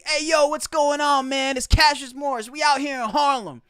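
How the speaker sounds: hammed-up fake dialect to fit in; put-on street dialect. Host mocking a fake Harlem vlog intro, loud put-on dialect. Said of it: "trying to change my dialect to fit in more".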